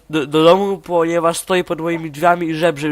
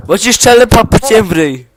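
raised, shouted playground threat; loud threat; 5 semitones higher, 7 dB louder than usual. Real, not acted. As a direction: threaten your friend loudly, pitched up. Threatens friend over a bad joke. (głośna, podniesiona groźba)

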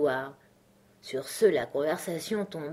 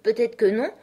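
higher, guarded concession; cautious reply; 5 semitones higher, 6 dB louder than usual. A narrator's reading. Alice answers the scornful Hatter, cautiously. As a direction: answer prudently, rising at the end. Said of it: "répondit-elle prudemment".

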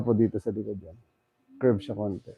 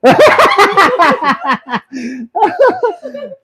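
loud high burst of laughter; long hearty laugh; 9 dB louder than usual. Real, not acted. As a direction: laugh out loud at his surprise. Laughing at the patient's surprise.

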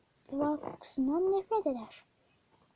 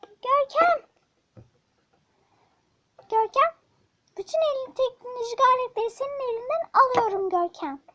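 angry mother; 7 semitones higher, 7 dB louder than usual. Acted. Angry mother calls son.

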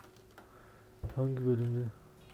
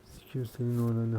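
usual speaking voice

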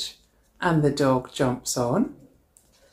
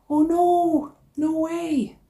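dismayed gasp; 11 semitones higher, slower than usual. A narrator's reading. Wand blows away in story.

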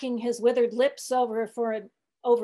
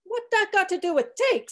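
put-on higher-pitched testy old cook; 11 semitones higher, 5 dB louder than usual. A narrator's reading. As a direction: protest testily in dialect. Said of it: "said the old blacks testily".

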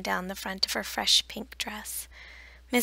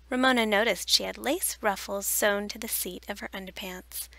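ordinary delivery

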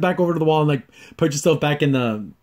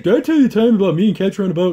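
fast, high and loud goofy cartoon voice; lightly acted excitable sidekick; goofy character voice; 5 semitones higher, 6 dB louder, faster than usual. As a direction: rattle it off like a giddy cartoon character. Recapping goofy cartoon dialogue.

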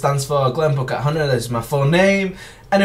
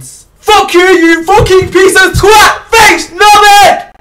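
slow, high, loud shouted insults; hammed-up furious outro; mock angry sign-off; 15 dB louder, slower than usual. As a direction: bellow the insults slowly.

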